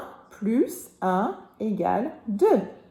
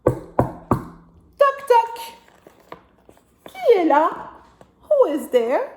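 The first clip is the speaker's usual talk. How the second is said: knock knock voice; 8 semitones higher, 6 dB louder, much slower than usual. Acted. Knock-knock game in story.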